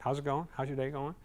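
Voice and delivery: calm, soft check-in; slowed gentle greeting. Shown as a demonstration. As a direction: ease into it gently for a steady client. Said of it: "I slow it down".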